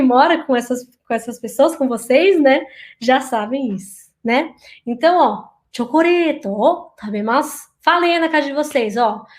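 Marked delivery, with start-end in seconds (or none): 5.7-7.7 careful model of "chocoreto, tabemasu" for students to repeat; Japanese pronunciation demo. Shown as a demonstration. Teacher models "chocoreto, tabemasu" for students to copy, careful demo. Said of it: "Procure imitar a pronúncia".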